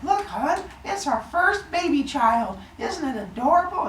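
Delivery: high, cooing new mom voice; doting mother. Retold or imitated. Rhea showing her husband their first baby, high cooing. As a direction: coo over the newborn in a high voice. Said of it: "she's like".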